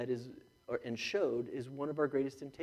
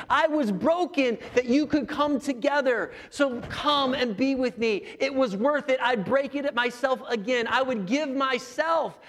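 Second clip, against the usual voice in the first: higher, louder impassioned preaching; full-force preaching; 9 semitones higher, 7 dB louder than usual. Acted. Pastor pleading with the congregation, higher and louder. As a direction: preach it with everything, driving each line.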